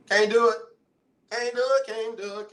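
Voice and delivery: "Can't do it." thrown out high and loud, hamming it up; overplayed theatrical refusal; mock dramatic refusal. Real, not acted.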